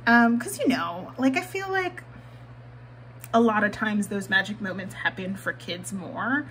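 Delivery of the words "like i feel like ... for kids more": ordinary delivery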